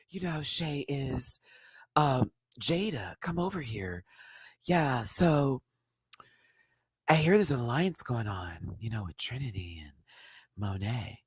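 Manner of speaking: soft-toned, unhurried queen; Shay. Retold or imitated. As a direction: confide it gently, in small careful pieces. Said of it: "Did I do a good Shay?".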